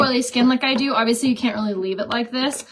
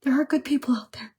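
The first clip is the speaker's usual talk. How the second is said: whispered sincerity; 3 semitones higher, faster than usual. Real, not acted. Sincere closing remark on the story.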